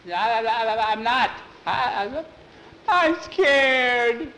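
high, loud stammer, slowing on the last words; stammering fear. Acted. Bowie admits to Skeeter he's terrified, stammering. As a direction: stutter out that you're scared. Stammering boy tied up at night.

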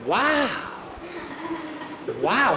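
put-on high-pitched child's wow; little boy's echoed wow. A narrator's reading. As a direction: copy the amazement in a small high voice. Small boy echoes Jackie's wow.

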